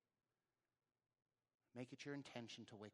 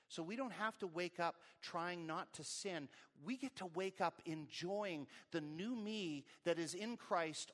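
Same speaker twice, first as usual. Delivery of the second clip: louder, higher, driving sermon delivery; impassioned preaching; 5 semitones higher, 6 dB louder than usual. Real, not acted. Preacher proclaiming freedom in Christ to congregation, impassioned. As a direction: preach it with rising fervor.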